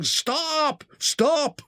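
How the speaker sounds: high shouted order; shouting. A narrator's reading. Pursuers shout at the escaping train, high shout. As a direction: yell after the fleeing train.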